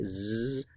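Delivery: "Z" as a long, soft buzzing zzz; the consonant "Z" alone, drawn out quietly; German S sound. Shown as a demonstration. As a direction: hold "Z" as a sustained voiced buzz. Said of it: "notice the pronunciation here".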